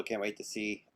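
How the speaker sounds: ordinary talk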